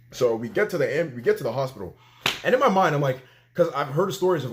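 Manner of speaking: ordinary talk, nothing marked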